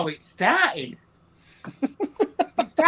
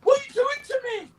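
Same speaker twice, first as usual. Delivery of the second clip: high, fast talking-dog voice; squeaky pet voice, rushed complaint; 6 dB louder, much faster than usual. Acted. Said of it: "I love the dog voice you do".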